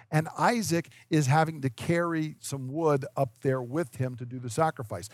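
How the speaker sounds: ordinary delivery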